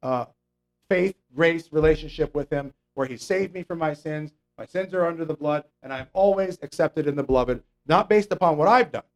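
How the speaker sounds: ordinary delivery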